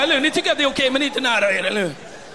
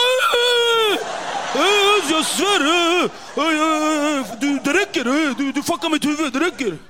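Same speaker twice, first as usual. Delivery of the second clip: angry outburst; 2 semitones higher, slower than usual. Retold or imitated. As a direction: explode in outrage. Zoran blir arg. (Zoran exploderar av ilska)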